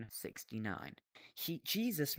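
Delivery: ordinary talk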